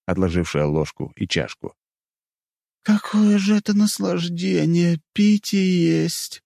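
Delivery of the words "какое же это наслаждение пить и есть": high, slow, savouring elf voice; drawn out in blissful delight; delighted elf girl. Acted. Elf savouring food and drink.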